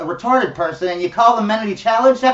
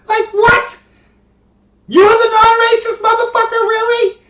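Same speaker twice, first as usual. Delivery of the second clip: high-pitched, loud incredulous outburst; mock disbelief; 12 semitones higher, 4 dB louder than usual. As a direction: squeal it loudly in exaggerated disbelief. Mocking the whisperer in disbelief.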